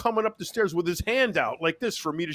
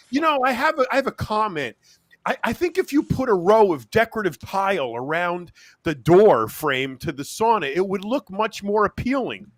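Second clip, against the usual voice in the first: chatty naked guy in sauna; 5 semitones higher, 5 dB louder than usual. Retold or imitated. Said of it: "And he said".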